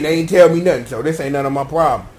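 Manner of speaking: usual speaking voice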